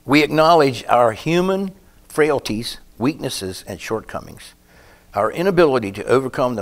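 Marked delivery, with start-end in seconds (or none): 0.1-4.5 louder, slow, weighty preaching; solemn sermon emphasis; preaching at full force. Acted. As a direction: lean into each word like a plea.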